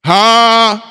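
loud, high incredulous huh; shocked huh. Retold or imitated. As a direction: blurt out disbelief. Shocked reply to wife.

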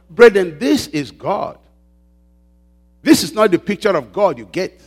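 usual speaking voice

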